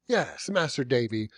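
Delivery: ordinary delivery, nothing marked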